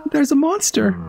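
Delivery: put-on a shy child tugging at a grown-up; polite little kid. The child politely gets the drunk knight's attention, in a high shy voice.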